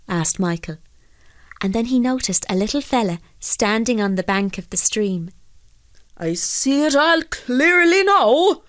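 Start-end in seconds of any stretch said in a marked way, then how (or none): 6.2-8.6 high, slow, loud indignation; angry calling out. A narrator's reading. Fisherman realises who tricked him, angry. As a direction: call it out angrily, stretching the words. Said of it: "called out Michael angrily".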